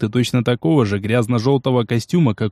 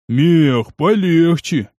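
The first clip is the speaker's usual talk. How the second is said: reproachful; 3 semitones higher, much slower than usual. Acted. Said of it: "укоризненно произнёс жирный".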